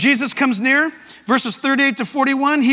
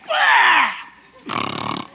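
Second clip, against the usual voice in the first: a high, breathy demonic shriek; harsh demon cry; 5 semitones higher than usual. Acted. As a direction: scream like a possessed man. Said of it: "Here's this demon guy".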